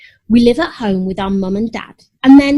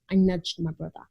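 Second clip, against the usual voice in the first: ordinary delivery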